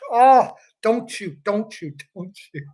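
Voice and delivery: hammed-up self-reproach, trailing down in pitch and volume; mock self-scolding. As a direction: play a player chiding himself, dying away. Player reminding himself, fading away.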